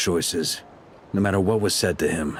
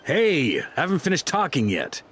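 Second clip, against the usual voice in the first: high-pitched protest falling away at the end; pitched-up call after someone interrupting; indignant objection; 7 semitones higher than usual. Acted. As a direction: object to being cut off.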